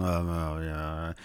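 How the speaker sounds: low, soft grudging mumble; mumbling. Retold or imitated. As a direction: mumble it low under your breath. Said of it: "they probably start mumbling".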